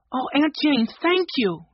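high, gushing thanks; grateful girl. A narrator's reading. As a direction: thank her in a high girl's voice. Jessie thanking her aunt gratefully.

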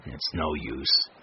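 low, slow resignation; dejected, drawn-out low voice; defeated. A narrator's reading. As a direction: give up, voice sinking low and slow. Charlie gives up after losing catch.

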